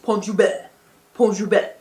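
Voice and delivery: "Pont Joubert" in exaggerated, punched syllables; teasing mock accent. Host brother repeating her "Pont Joubert", exaggerated teasing accent. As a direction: mockingly overdo each "Pont Joubert", ending hard. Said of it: "my host brother starts imitating me saying that".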